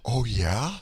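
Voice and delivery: hammed-up sultry come-on; mock sultry. Mocking sexy roleplay talk.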